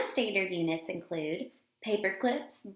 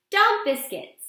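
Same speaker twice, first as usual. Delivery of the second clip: high, louder bright announcement; excited; 9 semitones higher, 6 dB louder than usual. Acted. Girl proudly naming her measuring unit, high and louder. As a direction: pop it out like a discovery.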